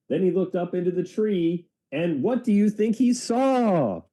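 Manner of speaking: put-on bright, rising storyteller voice for kids; reading to children. A narrator's reading. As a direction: draw the listener into the suspense. Reads children's book aloud.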